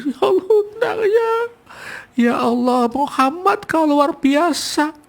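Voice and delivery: high, slow, weeping beggar's voice; acted tearful cry. Preacher voicing the beggar weeping over Muhammad, high and slow. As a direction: cry out in remorse, voice breaking. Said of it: "Nangis tuh, nangis".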